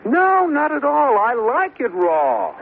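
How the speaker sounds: high, slow, overdone protest; exaggerated insistence. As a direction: lay it on thick to comfort her. Husband insists he likes raw bacon.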